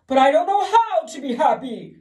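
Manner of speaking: high, loud sniffling king. Acted. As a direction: sniff it out tearfully. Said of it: "sniffed the king".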